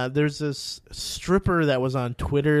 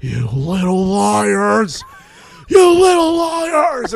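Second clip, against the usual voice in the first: slow, loud screaming, rising higher; hammed-up scream building much higher; screaming at girls; 6 semitones higher, 8 dB louder, much slower than usual. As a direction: scream it like a crazed Cage. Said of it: "he's, like, screaming at these girls".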